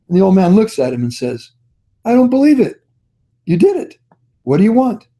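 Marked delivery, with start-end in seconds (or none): 2.0-5.1 much higher, mock astonished disbelief; a hammed-up gasp of wonder at the swimmer; amazed. Old man marvels at the swimmer who crossed, mock amazed.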